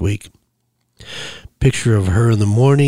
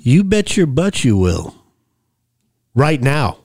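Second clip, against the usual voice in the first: emphatic, higher-pitched threat; stern insistence; 4 semitones higher than usual. A narrator's reading. Collector ordering the woman to repay, stern. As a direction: insist firmly, pitch raised then falling.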